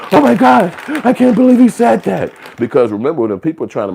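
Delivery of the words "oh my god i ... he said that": gasp, then loud high-pitched mock outrage; hammed-up, shrieky disbelief; scandalized shock. Mimicking scandalized people's reaction.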